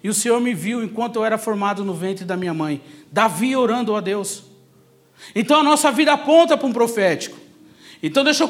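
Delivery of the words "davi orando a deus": usual speaking voice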